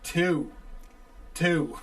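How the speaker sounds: "Two" with a full long oo; corrected "Two". Shown as a demonstration. Correcting himself for the caller, "Two" said fully. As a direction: say "Two" carefully with a clear oo vowel. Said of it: "I'm sorry".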